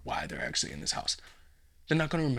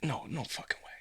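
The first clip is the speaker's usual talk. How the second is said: whispered disbelief; 8 dB quieter than usual. Acted. Burglar stunned disbelief.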